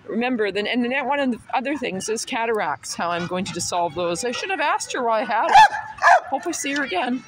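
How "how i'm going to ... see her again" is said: ordinary talk, nothing marked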